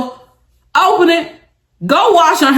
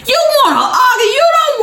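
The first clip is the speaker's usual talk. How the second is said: laughing shout; 12 semitones higher than usual. Acted. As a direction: shout "You wanna argue" with a laugh. Scolding girls who won't fight.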